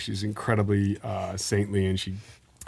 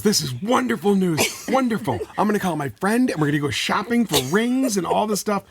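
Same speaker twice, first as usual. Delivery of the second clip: high, gushing excitement; delighted mom; 9 semitones higher than usual. Retold or imitated. Mom celebrating her son's engagement news, excitedly. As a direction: retell an overjoyed mom racing into plans.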